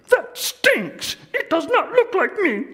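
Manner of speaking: very high, loud scornful outburst; rude Grover. Acted. Grover insulting Big Bird's picture, high loud scorn. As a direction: snap at the painting. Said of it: "letting me do the voices".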